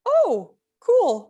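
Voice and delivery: high, loud acted "oh" of discovery; delighted realization. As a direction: react as if it suddenly clicks. Acting her delighted response.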